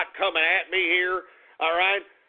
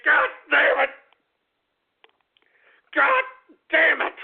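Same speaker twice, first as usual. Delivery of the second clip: much higher and louder explosive curse; furious shout; 7 semitones higher, 6 dB louder than usual. Acted. Host exploding at a stupid country, furious shouted curse. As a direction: blow up on the words. Host's rant peaks in a shout.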